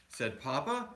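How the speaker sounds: ordinary delivery, nothing marked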